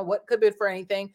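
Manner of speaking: ordinary talk, nothing marked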